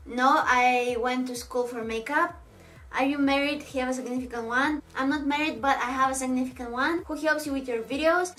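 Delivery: ordinary talk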